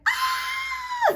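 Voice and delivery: very high, loud scream; acted panic scream. Storyteller re-enacting her scream at the shots, high and loud. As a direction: shriek as if shots just went off. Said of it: "start screaming".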